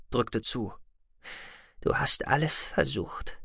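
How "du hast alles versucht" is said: soft, slow, kindly reassurance; gentle, good-natured. A narrator's reading. Sahira consoling Goloshir as she takes his hand, gentle. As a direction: say it softly and unhurriedly. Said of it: "Ihre Stimme klang sanft und gutmütig".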